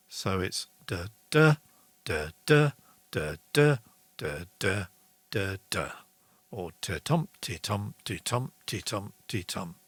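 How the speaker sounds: te TUM te TUM, stressing every second beat; iambic beat. Shown as a demonstration. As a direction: tap out the iambic rhythm, da DA. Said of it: "is iambic pentameter".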